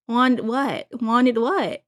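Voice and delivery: loud, mock-confused question; imitated bewildered outburst; baffled reaction. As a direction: blurt it out as if you misheard. Acting out a baffled mishearing.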